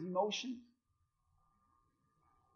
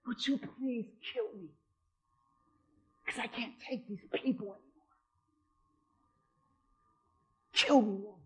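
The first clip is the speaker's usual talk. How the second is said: soft, weary plea to die; despairing plea; 10 dB quieter than usual. Retold or imitated. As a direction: beg quietly, worn out. Moses begging God to die.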